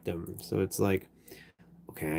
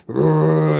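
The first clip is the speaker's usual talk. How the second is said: short mock growl; imitating a grumble, a bit louder; imitated grumble; 4 semitones higher, 5 dB louder than usual. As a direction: growl like a grumpy dad. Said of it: "and he grumble".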